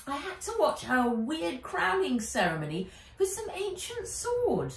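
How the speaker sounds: put-on girl voice pitched high, urgently telling it all; agitated girl Nat. A narrator's reading. Nat tells her guardian about the crowning ceremony: high, agitated girl voice.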